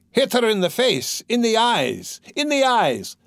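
high, frenzied urging; cried out. A narrator's reading. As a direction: egg them on, pitched far up. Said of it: "cried Mikolka".

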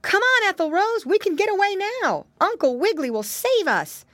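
very high, excited character voice; high-pitched rallying cry; urgent cry. A narrator's reading. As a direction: urge a friend to escape, high and eager. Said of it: "so he cried".

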